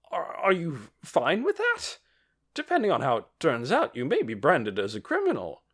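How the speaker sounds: high, flustered warning; rattled official warns. A narrator's reading.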